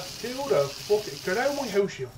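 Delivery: high, loud shout at an intruder; angry shout. Real, not acted. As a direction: yell him out of the house. Intruder enters his base.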